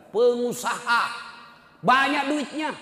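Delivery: slow, high, loud stress on Pengusaha; pointed emphasis. Real, not acted. Preacher stresses to the congregation that the son is rich. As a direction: drag out Pengusaha then punch Banyak duitnya.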